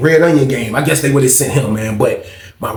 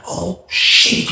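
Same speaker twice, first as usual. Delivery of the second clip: whispered shock; 4 semitones higher than usual. Retold or imitated. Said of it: "I'm like".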